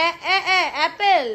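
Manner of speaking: drawn-out এ, এ, এ, then অ্যাপেল with a laugh; prompting sounds. Shown as a demonstration. Mother prompts her child toward the word, laughing. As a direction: coax the first sound out, laughing. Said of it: "এটা কি বলে?".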